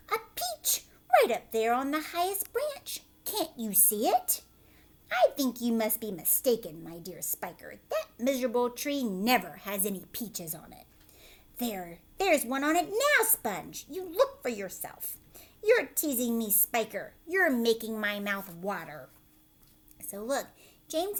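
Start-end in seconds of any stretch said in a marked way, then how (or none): 0.1-4.4 high, loud insistent cry; excited aunt. Acted. Aunt Spiker insisting to Sponge the peach is there. 5.1-19.1 loud, doubting fat aunt voice; scoffing aunt. Acted. Aunt Sponge doubting Spiker about the peach.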